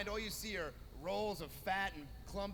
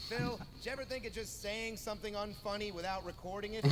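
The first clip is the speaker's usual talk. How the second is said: normal talking voice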